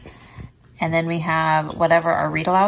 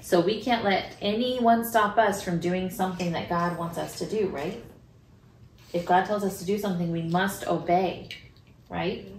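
put-on reading to children; 4 dB quieter than usual. Reads Bible story, prays with child.

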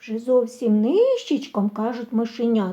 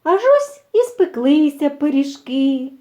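ordinary delivery

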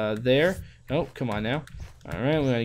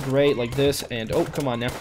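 ordinary delivery

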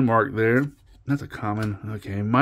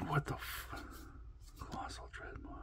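stunned whisper; 4 semitones higher, 23 dB quieter than usual. Real, not acted. Stunned by surprise card.

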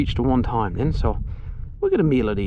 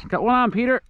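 calling out; 11 semitones higher than usual. Real, not acted. Calling to Peter across pond.

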